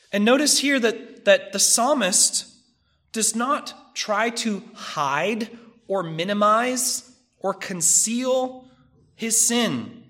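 ordinary delivery

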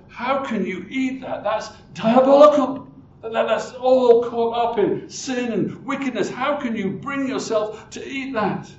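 high, loud scandalized protest; outraged believer. Acted. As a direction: play the shocked, indignant churchgoer. Preacher acts outraged believer.

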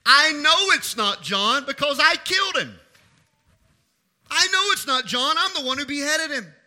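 high, forceful declaration without hesitation; emphatic Herod. Acted. Preacher as Herod admitting he killed John, emphatic. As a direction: state it hard, with no hint of regret. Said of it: "Herod emphatically, emphatically and without any emotional hesitation".

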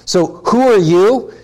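rising challenge on you; stress on you. Shown as a demonstration. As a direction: lean on you as a question. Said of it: "emphasize the you".

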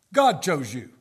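proclaimed. Acted. Proclaiming election from the pulpit.

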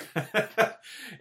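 a high, bursting laugh; real laugh. Real, not acted. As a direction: laugh out loud at yourself. Laughs at his ending.

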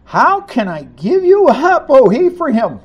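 God's heart breaking, pleading loud; impassioned plea as God. Acted. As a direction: cry out like a grieving father. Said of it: "here you hear the heartbeat of God".

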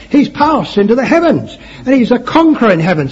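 loud, high, rousing preacher's proclamation; preaching. Real, not acted. Preacher exalting the risen Saviour to his congregation, preaching. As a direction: build into full-force preaching of praise.

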